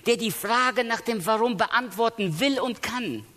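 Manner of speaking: high, impassioned preaching, voice lifted; preaching at full force. Acted. Preacher to the congregation about Jesus crying out, impassioned preaching. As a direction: raise the voice as Jesus cries out on the cross.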